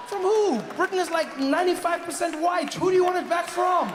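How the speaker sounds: high, loud disbelief; incredulous outburst. Acted. Comedian challenging Brexit voters, high-pitched incredulous shout. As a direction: shout the question in exasperated amazement.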